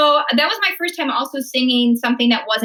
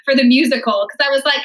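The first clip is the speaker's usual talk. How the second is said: ordinary talk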